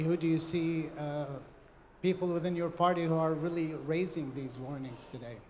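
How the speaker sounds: ordinary delivery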